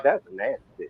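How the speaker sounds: mock quiet aside, laughing. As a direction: say it under your breath, back in line. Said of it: "He's all quiet. He's like".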